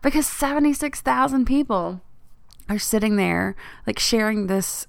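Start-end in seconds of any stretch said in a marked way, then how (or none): 0.0-2.0 choked-up, high and tight with real tears; fighting back happy tears; teary. Real, not acted. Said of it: "I'm crying on a freaking podcast again".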